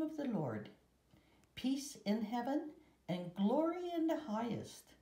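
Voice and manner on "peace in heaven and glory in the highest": ordinary delivery, nothing marked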